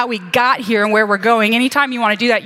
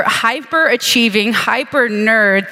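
slow drawn-out hyper-nerd; much slower than usual. Real, not acted. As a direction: stretch out "hyper-nerd" very slowly. Mocking self-description, drawn out.

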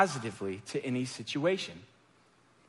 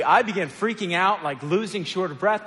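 ordinary talk, nothing marked

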